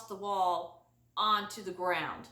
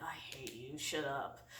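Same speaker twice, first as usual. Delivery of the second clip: sulky whisper; 9 semitones lower, 14 dB quieter than usual. Retold or imitated. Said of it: "He's like".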